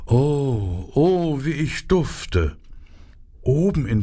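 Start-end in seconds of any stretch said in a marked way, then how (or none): 0.1-2.6 a lightly voiced, higher preening flower; self-admiring flower, swooning at herself; vain narcissus. A narrator's reading. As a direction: sigh over your own scent. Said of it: "sagte die Narzisse".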